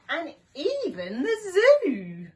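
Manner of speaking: high, loud and slow, wide-eyed; excited character voice. Acted. Dog robber listing places he couldn't rob, excitedly. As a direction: build to a big finish on the zoo.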